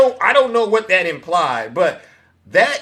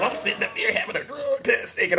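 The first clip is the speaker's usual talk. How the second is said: laughing retelling; 6 semitones higher, 7 dB quieter than usual. Retold or imitated. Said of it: "Zeus is off the rails".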